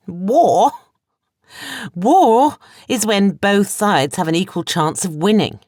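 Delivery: high, loud, scornful scoff. A narrator's reading. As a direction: scoff at the very idea. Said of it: "Sophia scoffed".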